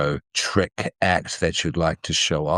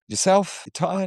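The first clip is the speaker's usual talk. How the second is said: high-pitched voice. Pretend. High, mock-sweet question to co-host.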